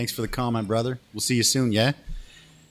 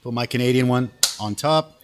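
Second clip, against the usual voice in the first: normal talking voice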